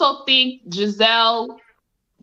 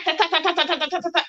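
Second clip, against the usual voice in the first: higher, fast machine-gun stutter; rapid-fire rattle; 4 semitones higher, 5 dB quieter, much faster than usual. Retold or imitated. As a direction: rattle it off like gunfire. Imitates rapid-fire verbal attack.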